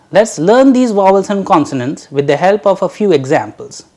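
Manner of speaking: normal talking voice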